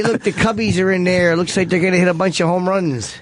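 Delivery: put-on Chicago fan accent; broad Chicago regular-guy talk. Shown as a demonstration. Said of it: "I do my Chicago accent thing".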